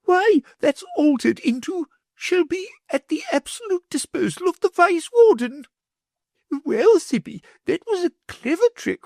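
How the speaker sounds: high, sugary lady's voice; greedy wife in a high fluting voice; the Wardeness. A narrator's reading.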